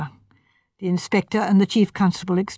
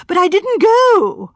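higher, louder and slow, insisting; high and excited; 11 semitones higher, 6 dB louder, slower than usual. A narrator's reading. Suspect insists to inspector, high, loud and excited. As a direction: protest it, voice climbing. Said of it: "Sandford's voice rose high and excited".

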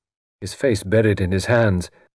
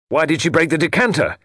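raised and higher, demanding an answer; crying out; 8 semitones higher, 4 dB louder, faster than usual. Acted. Portal in anguish demanding why his wife broke the decanter, crying out. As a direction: cry it out at the room. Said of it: "he cried".

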